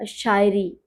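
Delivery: "शायरी" with a hushing sh; correct pronunciation. Shown as a demonstration. Urdu poet introduces another word to learn, careful correct pronunciation. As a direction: demonstrate "शायरी" starting with sh. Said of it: "शायरी नहीं".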